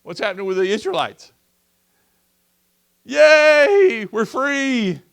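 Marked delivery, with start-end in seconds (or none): 3.0-5.0 excited cheer. Acted. Freed Israelites voiced celebrating.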